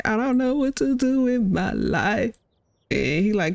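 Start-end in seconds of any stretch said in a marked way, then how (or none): 0.0-2.4 higher, slow fretful grandmother; mock grandma whine. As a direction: stretch it out like a helpless old lady. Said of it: "being like".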